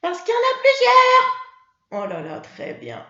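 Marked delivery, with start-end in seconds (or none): 0.0-1.4 Mimi. Acted. Mimi the puppet answering her teacher, high child voice.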